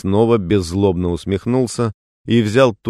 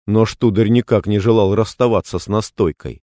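usual speaking voice

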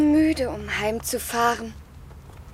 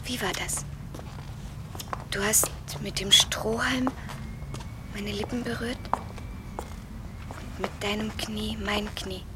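soft intimate whisper, much softer; hushed, remembering a kiss; 3 semitones lower, 11 dB quieter than usual. Acted. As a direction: whisper the memory close and tender. Intimate memory recalled at night.

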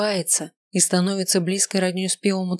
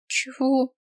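tearful voice; 7 semitones higher than usual. Acted. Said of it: "спрашиваю заплаканным голосом".

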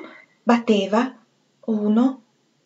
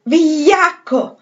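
higher, louder, slow drawn-out yell; furious shout; 7 semitones higher, 5 dB louder, much slower than usual. A narrator's reading. Holmes bursting in, shouting at the jumped Septimus. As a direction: hurl the insult with full force. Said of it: "gridò il dottor Holmes".

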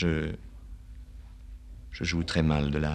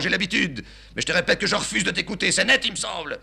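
put-on high, haughty old man; father declaring he refuses to listen, high-pitched; wounded old father; 13 semitones higher, 4 dB louder than usual. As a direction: play offended dignity.

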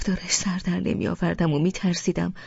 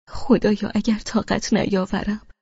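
plain reading voice

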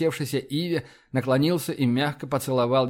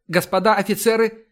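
higher, raised call to attention; shouted command; 6 semitones higher, 4 dB louder than usual. A narrator's reading. Iva as the admiral enters, shouted command. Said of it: "крикнула Ива".

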